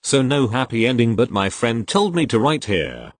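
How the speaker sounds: plain reading voice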